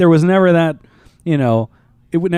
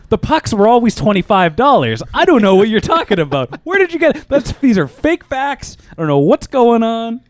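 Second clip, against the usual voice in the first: higher-pitched, defensive spokesman's protest; Sean Spicer; 7 semitones higher, 4 dB louder than usual. Acted. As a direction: do an indignant press secretary denying it all. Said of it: "That was too clear. There wasn't enough stumbling there to be spicy".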